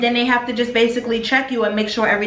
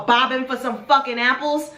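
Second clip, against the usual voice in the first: ordinary talk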